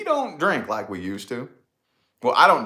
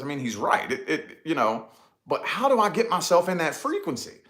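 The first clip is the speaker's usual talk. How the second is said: ordinary delivery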